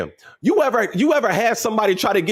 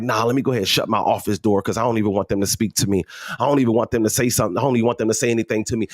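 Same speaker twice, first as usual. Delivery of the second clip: lower, fast annoyed inner voice; annoyed aside; 8 semitones lower, faster than usual. Retold or imitated. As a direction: rattle it off, fed up with them. Voicing himself avoiding people.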